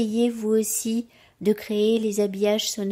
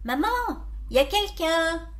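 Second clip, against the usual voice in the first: raised-pitch, louder call to mother; mild shout to another room; 5 semitones higher, 4 dB louder than usual. A narrator's reading. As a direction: call through the house, questioning. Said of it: "Je crie".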